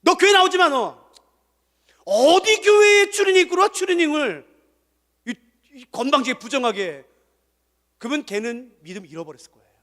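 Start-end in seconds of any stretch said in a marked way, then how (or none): 0.0-7.1 loud, high angry scolding; harsh rebuke. Shown as a demonstration. Pastor showing the wrong way to greet the boy, loud and angry. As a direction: drive him out harshly. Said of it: "그러면 얼마나 좋겠어요".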